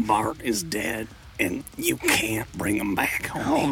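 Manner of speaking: slow, raspy, unsympathetic wife impression; Marge Simpson. Wife to grieving husband, mock cold finality. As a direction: drag it out coldly in a cartoon rasp. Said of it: "Marge having no sympathy for him".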